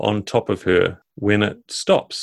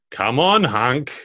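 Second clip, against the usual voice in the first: dragon voice; 5 semitones higher than usual. Acted. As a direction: tease him as the dragon. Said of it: "you hear the voice of the dragon".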